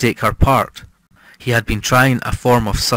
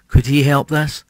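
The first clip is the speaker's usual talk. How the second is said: ordinary delivery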